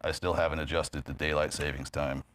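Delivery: low, soft deadpan one-note delivery; Stephen Wright deadpan. Retold or imitated. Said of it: "a monotonous voice, and he would say".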